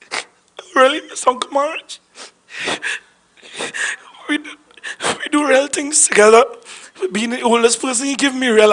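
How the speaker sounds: halting, high, tearful voice; choked with grief. Real, not acted. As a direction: struggle through tears with long pauses. Grieving, long pauses in eulogy.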